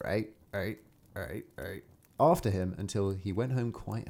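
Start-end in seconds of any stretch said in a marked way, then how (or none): 0.1-1.8 fading frog croak. A narrator's reading. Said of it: "but the frogs still cried".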